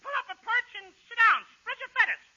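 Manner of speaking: put-on high little-boy voice; put-on child's voice; [name]. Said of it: "Oh, high voice".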